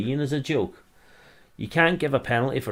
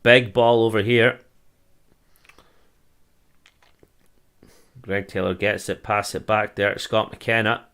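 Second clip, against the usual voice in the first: fan calling the match as it happens, a touch louder on the action; live play-by-play; 5 dB louder than usual. Acted. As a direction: call the attack like a pundit on the telly.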